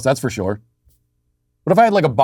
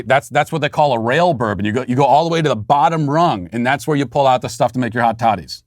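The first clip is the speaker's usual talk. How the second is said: normal talking voice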